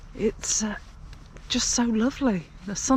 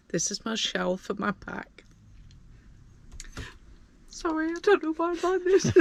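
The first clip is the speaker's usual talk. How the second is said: getting emotional; 9 semitones higher, 4 dB quieter than usual. Real, not acted. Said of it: "oh, I'm gonna get emotional".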